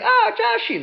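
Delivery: very high, loud maternal coo; doting mother. Acted. Mother recognizes her son on phone, high doting cry. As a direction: swoop way up as a delighted mom.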